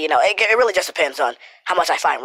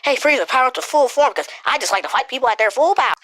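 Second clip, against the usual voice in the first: higher, goofy Goku voice with a touch of eager cheer; mock Goku; 7 semitones higher than usual. Mocking Goku as a dumbass, lightly voiced higher. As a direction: lightly play a dim, fight-happy Goku. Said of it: "It wasn't Goku just being a dumbass and like".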